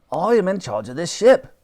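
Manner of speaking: put-on indignant old Admiral; pompous Admiral. A narrator's reading. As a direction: assert your rank.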